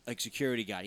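ordinary talk, nothing marked

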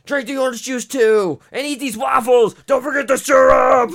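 hammed-up medic shoving food at him; mock frantic medic. Mocking EMTs feeding the man sugar, building to a shout.

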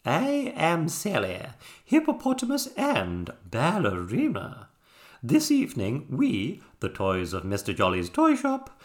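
high, swooping theatrical hostess voice; grand show announcer. A narrator's reading. Hippo ballerina presenting the toys' show, grand announcing. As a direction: announce it like a grand stage diva.

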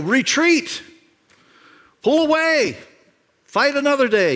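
high, loud call to fall back; urgent call to retreat. Acted. Preacher voicing the army's logical choice to retreat, urgent high call. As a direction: call out a retreat across the ranks.

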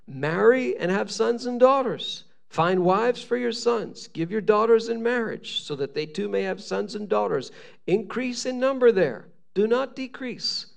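high, declaimed Bible commands; scripture reading. Acted. Preacher reads God's letter to the exiles, declaiming.

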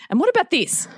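high-pitched mock scolding; nagging challenge. Parent nagging, acted out.

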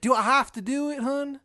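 high, loud, whining complaint; pained Shithead Steve. Acted. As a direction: whine in a put-on high Maryland voice. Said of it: "I was like".

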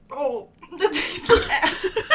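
slow, loud, laughing disgust; disgusted groan. Real, not acted. Tasting the stuffing flavor.

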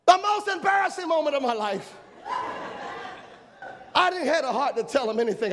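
high, laughing confession. Real, not acted. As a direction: laugh at your own embarrassment. Laughing at himself.

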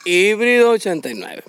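slow declaimed verse with stretched line endings; mock poem recital. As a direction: declaim it like a grand comic bard. (declamación poética cómica, alargando los finales)

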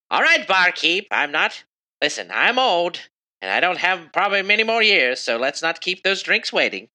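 put-on high old-lady queen voice; elderly queen, impatient. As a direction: chivvy the barkeep along, pitched high.

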